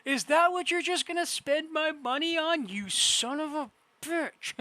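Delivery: playful exasperation; mock outrage. Real, not acted. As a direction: scold the screen like a cheated partner. Berating enemy for buying bows.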